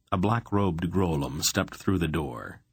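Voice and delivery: usual speaking voice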